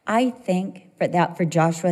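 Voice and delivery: ordinary delivery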